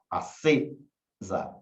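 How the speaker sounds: "Acesa" drawn out slowly, the CE held as the stressed syllable; stress on the tonic syllable. Shown as a demonstration. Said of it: "Qual que é a tônica de acesa?". ("Acesa" devagar, destacando a sílaba tônica CE)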